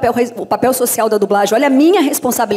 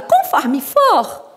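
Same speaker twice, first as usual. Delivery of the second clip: high cartoon voice, drawn out; Minnie; 6 semitones higher, much slower than usual. Dubber putting the phrase into her cartoon's mouth, high cartoon voice. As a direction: stretch it out in a squeaky little voice. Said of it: "Com a Mini".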